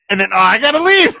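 loud, high-pitched mock hurry; retold interrupted story. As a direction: act out breaking off to leave. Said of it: "He's like".

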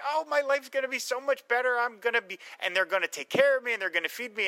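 imitating a hopeful young girl, lifted high; eager kid piping up about her new life; chipper little girl. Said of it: "she's all chipper".

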